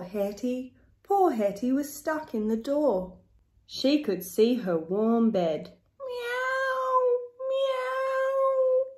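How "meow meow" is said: two long, high cat wails; crying meow. A narrator's reading. Narrator voices cat stuck in the door, crying out. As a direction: meow plaintively, high and stretched. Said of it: "she cried".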